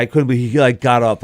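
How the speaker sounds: ordinary talk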